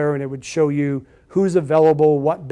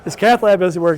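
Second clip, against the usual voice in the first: laughing disbelief; 4 semitones higher, 7 dB louder than usual. Retold or imitated. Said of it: "It's like".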